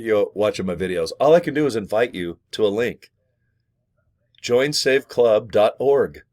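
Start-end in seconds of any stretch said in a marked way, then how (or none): 4.4-6.2 drawn-out web address for viewers; slow, spelled-out address. Shown as a demonstration. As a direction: join save club dot org said slowly, each piece separate. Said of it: "Can you remember that?".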